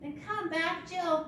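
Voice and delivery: high, loud playful call; high call to a runaway bird; calling the bird back. Pretend. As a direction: call Jill back brightly from far off. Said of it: "Let me hear you".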